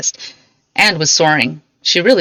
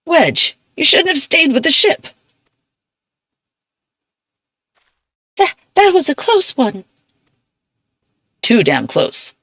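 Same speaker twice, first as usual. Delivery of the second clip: lightly raised voice of a relieved crewman; relieved comrade; 6 semitones higher than usual. Acted. Engineer reunited with his comrade after the crash, relieved and lightly voiced. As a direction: greet your friend with relief.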